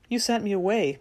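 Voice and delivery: ordinary delivery, nothing marked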